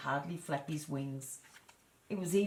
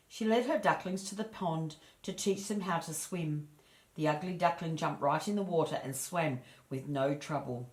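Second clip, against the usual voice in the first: plain reading voice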